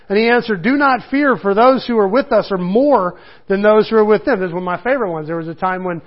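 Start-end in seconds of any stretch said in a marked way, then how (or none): 0.6-4.4 a slightly raised, earnest Bible reading; scripture reading. Acted. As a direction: read it out to the congregation. Reads scripture aloud.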